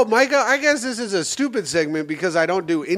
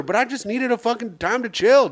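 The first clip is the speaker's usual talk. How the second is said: ordinary delivery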